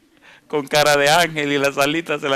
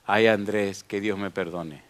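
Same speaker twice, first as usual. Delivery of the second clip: weak, much softer, slow plea; dying mother; 6 semitones lower, 6 dB quieter than usual. Retold or imitated. The dying mother speaks to her son Andrés, weak and soft. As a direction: fade to a broken murmur.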